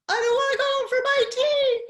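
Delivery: put-on high, loud whining little girl; whiny child. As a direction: whine it like a cross five-year-old. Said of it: "And her daughter goes".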